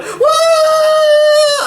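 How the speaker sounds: put-on child yelling. Kid yelling while his father carries him out, high and loud. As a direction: let out a kicking kid's howl.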